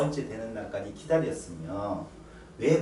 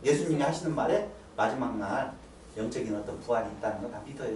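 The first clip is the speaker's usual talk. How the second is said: usual speaking voice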